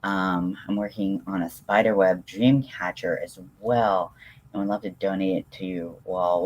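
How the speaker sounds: normal talking voice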